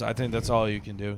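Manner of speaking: normal talking voice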